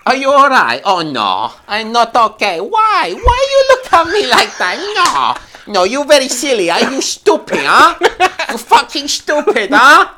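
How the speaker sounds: loud, high, hammed-up Korean accent; exaggerated Korean accent, swooping pitch; strong mock Korean accent. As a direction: scold in a caricature Korean accent. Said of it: "strong Korean accent".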